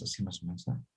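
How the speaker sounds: usual speaking voice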